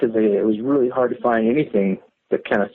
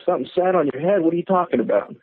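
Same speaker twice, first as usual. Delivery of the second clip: higher, startled disbelief; incredulous; 7 semitones higher, faster than usual. Retold or imitated. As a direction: react like you can't believe what you heard. Disbelieving reply to scared nephew.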